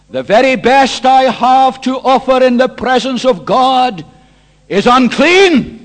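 loud, emphatic preaching; full-force confession. Acted. Preacher voicing Isaiah before God, loud emphatic confession. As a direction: hammer it out, slowing on the last words.

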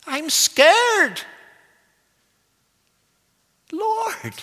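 high, loud, panicked cry to the Lord; desperate disciples shouting over the storm; frightened plea. Acted. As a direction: wake him up in terror, voice climbing. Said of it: "this is what they blurt out".

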